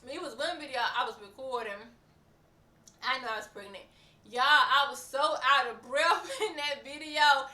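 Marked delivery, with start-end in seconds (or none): 4.3-7.5 laughing through the words, a touch louder; amused laugh breaking into the line. Real, not acted. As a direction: chuckle as you remember it. Laughing recalling being breathless.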